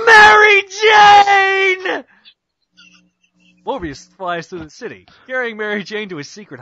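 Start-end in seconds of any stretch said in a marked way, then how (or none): 0.1-2.0 high, slow, anguished shout; desperate cry. Acted. Peter crying out to Mary Jane as Morbius carries her off, anguished shout. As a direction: scream her name as she's taken. Peter calls after kidnapped girlfriend.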